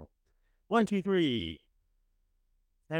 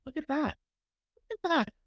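excited; 12 semitones higher, faster than usual. Real, not acted. Excited at list.

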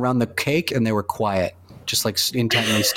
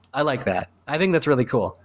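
usual speaking voice

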